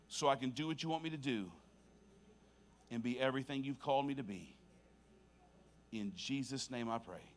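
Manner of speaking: soft, phrase-by-phrase prayer with long pauses; quiet prayer fading low to the Amen; repeat-after-me prayer. Real, not acted. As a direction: lead them gently, leaving room to echo. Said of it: "repeat this prayer after me".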